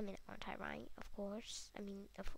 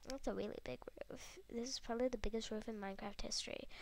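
whispering; 6 dB quieter, faster than usual. Real, not acted. Awed whisper.

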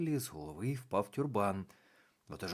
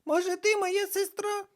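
put-on much higher, louder girlish witch voice; little witch; 7 dB louder than usual. A narrator's reading. Мала баба Яга питає карнавальну, високий дитячий голос. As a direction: play a small cheeky witch. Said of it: "відповіла та".

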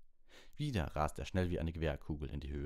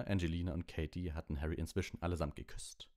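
plain reading voice

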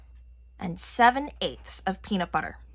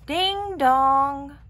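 high sung doorbell chime; doorbell ding dong; 6 semitones higher, 3 dB louder than usual. Acted. Pizza arrives at the door, sung doorbell chime.

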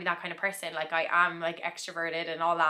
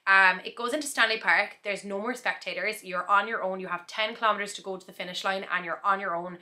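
normal talking voice

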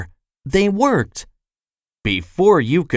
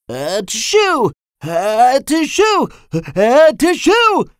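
three sneezes, each louder and higher; sneezing; 11 semitones higher, 4 dB louder, much slower than usual. A narrator's reading. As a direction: sneeze three times, building up. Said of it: "sneezed Mister Sneeze".

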